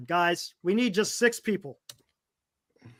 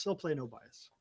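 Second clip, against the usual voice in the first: quiet aside; 9 semitones lower, 17 dB quieter than usual. Real, not acted. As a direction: lower and much softer, muttered repeat.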